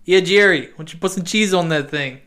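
put-on hammy Boston dockworker accent; mock Boston accent. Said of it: "heard a man from Boston talk".

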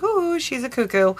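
mocking skeptic. Mimics skeptical listeners.

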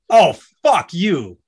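loud, high, slowed-down curse; emphatic curse. Shown as a demonstration. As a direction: punch each word slowly and loudly. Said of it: "I just said it three different ways".

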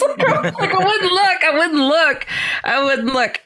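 high-pitched words bubbling with laughter; laughing. Real, not acted. Retelling how she hid her face, laughing. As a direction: laugh through it, easing off.

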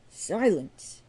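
bang out a loud call for order; loud call for silence. A narrator's reading. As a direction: call the whole room to order. Calling the bar to order.

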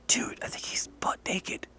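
hushed, slow aside to a buddy; secretive whisper. Acted. Freddie to his friend about the naked man, whispering. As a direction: whisper it slowly so he won't hear. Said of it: "his friend Freddie goes".

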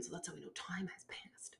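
dropped to a whisper; whispered wry aside. Real, not acted. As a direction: breathe it under your breath. Aside about the cut.

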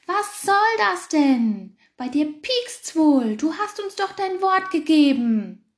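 boil over at the fox; furious. A narrator's reading. Hedgehog furious at the fox for breaking his word. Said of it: "kochte vor Wut".

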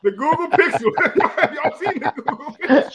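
high-pitched, laughing mock amazement; teasing, laughing. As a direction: tease him through laughter, voice jumping up. Teasing friend's predicted switch to Pixel.

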